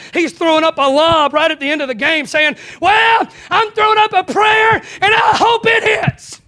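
high, loud, hammed-up hopeful shout; mock thief's shout. Preacher mocking the thief's last-second prayer, high hammed-up shout. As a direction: lob the prayer up like a desperate buzzer beater.

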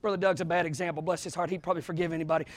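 a playful dig under the breath; muttered aside; quiet aside. Real, not acted. Aside about Brother Doug.

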